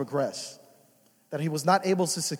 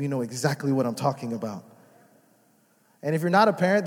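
ordinary talk

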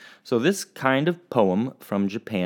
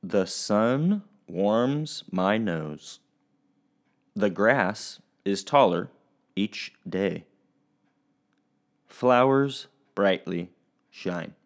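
slow, spaced-out poem reading; measured haiku recital with long pauses; much slower than usual. Shown as a demonstration. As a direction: recite it line by line, letting each word hang. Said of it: "my haiku goes like this".

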